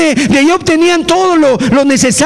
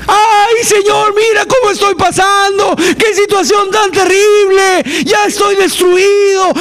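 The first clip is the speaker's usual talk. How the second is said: anguished crying out; 6 semitones higher than usual. Pretend. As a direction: cry out to God in despair. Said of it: "estaban en un clamor, un alarido, gritos". (clamor desesperado, con voz quebrada y aguda)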